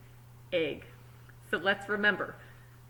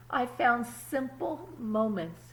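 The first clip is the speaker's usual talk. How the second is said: put-on calm storybook reading to kids; story narration; 2 semitones higher, slower than usual. A narrator's reading. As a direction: read it like a picture book at story time. Reads the story aloud.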